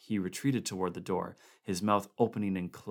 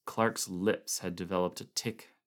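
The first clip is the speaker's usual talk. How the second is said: plain reading voice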